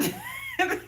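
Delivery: high-pitched laugh; real high giggle. Real, not acted. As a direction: laugh at your own story. Laughs at her own retold refusal.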